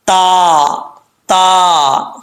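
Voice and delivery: "ता" light and thin, tongue tip at upper teeth, vowel held; long, light "ता". Shown as a demonstration. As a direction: say "ता" without thickening it. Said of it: "मोटा नहीं पढ़ना।".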